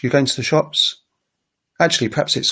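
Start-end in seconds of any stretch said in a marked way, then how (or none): 0.0-1.0 "You going to the shops" higher, rising on "shops"; English question rise. Shown as a demonstration. Teacher comparing with French, "You going to the shops" as English rising question. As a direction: ask "You going to the shops" with a final lift. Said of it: "more sing-song than in English".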